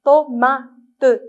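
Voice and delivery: "tomate" split loudly as to, ma, te; "tomate" in loud separate beats, final te sounded; syllable by syllable. Shown as a demonstration. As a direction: punch out each of the three syllables of "tomate". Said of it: "où vous entendez le son ma".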